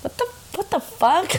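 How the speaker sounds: high, baffled reaction; mock disbelief. Friend reacting to a blunt text, mock disbelief. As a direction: act out stunned disbelief in a high voice. Acted shocked reply to text.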